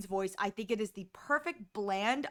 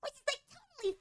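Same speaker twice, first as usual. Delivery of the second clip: put-on much higher, sweet little-girl anime voice; high cutesy anime girl; 13 semitones higher than usual. Shown as a demonstration. Demoing the cute high-voiced girl character type, high and cutesy. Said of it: "character that sounds like this".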